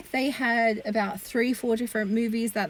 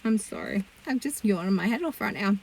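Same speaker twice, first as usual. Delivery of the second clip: ordinary delivery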